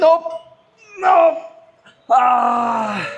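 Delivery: loud pained cry; strained yell. Real, not acted. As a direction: cry out at failure. Straining at failure.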